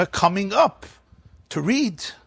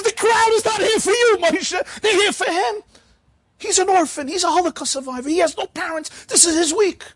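much higher, firm lecturing voice; scolding gabbai. Retold or imitated. Gabbai telling Moshe the crowd came for the orphan, high and firm. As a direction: set the old man straight. Gabbai rebukes old man.

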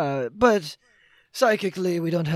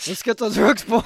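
lightly raised rally call; rallying cry; 4 semitones higher, 6 dB louder than usual. Acted. The game master cheers on the boys, a little raised. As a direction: pump up the team.

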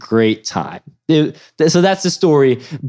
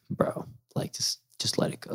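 unvoiced pleading whisper; begging the guard to let it go, whispering; whispered plea; 15 dB quieter than usual. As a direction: whisper it close to his ear.

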